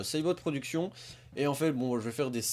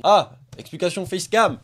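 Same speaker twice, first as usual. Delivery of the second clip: high, very loud cry of discovery; excited shout; 8 semitones higher, 15 dB louder, slower than usual. Real, not acted. Vlogger finding the video they searched for, excited shout. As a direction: shout it out high, drawing out the last words.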